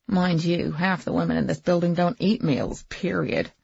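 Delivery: ordinary delivery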